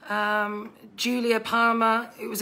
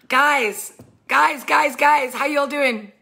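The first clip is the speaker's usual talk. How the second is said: raised, excited call to the viewers; 5 semitones higher, 6 dB louder, slower than usual. Real, not acted. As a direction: grab everyone's attention, higher and louder. Calling out to viewers.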